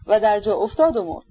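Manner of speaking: usual speaking voice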